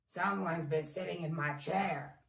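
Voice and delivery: low, loud bear growl; growling Daddy Bear. A narrator's reading. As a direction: growl like a cross papa bear. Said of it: "he growled".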